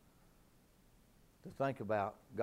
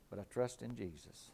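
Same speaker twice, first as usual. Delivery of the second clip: dropped soft and low after the shout; quiet; 3 semitones lower, 7 dB quieter than usual. Acted. Preacher answering his own shout, softly and low. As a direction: pull it right down, almost hushed.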